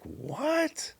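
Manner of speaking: high, baffled "What? Me?" as Rick; mimicking Rick's shock. Pastor on stage hears wife call him angry, high shocked. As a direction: play Rick, stunned and pointing at himself. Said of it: "basically mimicking Rick".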